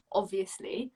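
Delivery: whispered aside. Real, not acted. Cheeky aside about herself.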